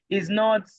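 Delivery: usual speaking voice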